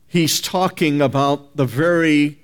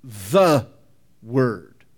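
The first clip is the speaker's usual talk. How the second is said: "the" alone, pause, then "Word" dropped lower; stress on THE; 3 semitones lower than usual. Shown as a demonstration. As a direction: isolate "the" with a firm pause after it. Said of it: "which makes it the definite article".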